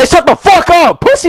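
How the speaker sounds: real angry high-pitched yell. Real, not acted. Player telling a rival to shut up, angry yelling. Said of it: "Stop yelling".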